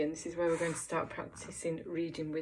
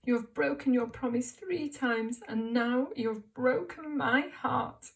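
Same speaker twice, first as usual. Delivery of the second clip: sad voice; 6 semitones higher, 5 dB louder, slower than usual. Shown as a demonstration. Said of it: "let's say it in a sad voice".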